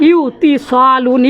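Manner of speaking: ইউতিসালুন said very slowly to show it is hard; slow hard form. Shown as a demonstration. Teacher shows students the clumsy form, slow. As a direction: drag out ইউতিসালুন awkwardly. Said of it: "ইউতিসালুন কঠিন হয়ে যাচ্ছে".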